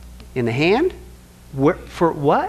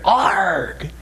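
playful pirate growl, lightly done; 3 semitones lower than usual. Pretend. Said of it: "It's a pirate".

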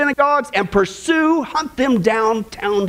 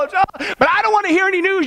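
mock believer; 7 semitones higher than usual. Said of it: "you say".

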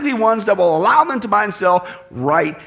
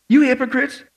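higher, raised-voice accusing question; incredulous rebuke; 4 semitones higher than usual. Acted. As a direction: throw it at them in disbelief. Voicing Jesus's rebuke, second take.

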